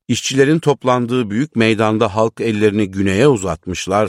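usual speaking voice

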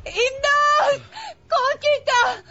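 high, frantic screaming; panicked cry for help. Acted. Daughter screams for help, father collapses.

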